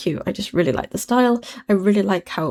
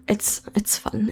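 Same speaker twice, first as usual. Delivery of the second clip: slow breathy whisper; 6 dB quieter, much slower than usual. Real, not acted. Soft tired aside.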